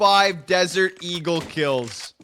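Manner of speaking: loud, high, drawn-out caster shout; excited commentary. Real, not acted. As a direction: roar the big play in amazement. Caster shouting at stunning round.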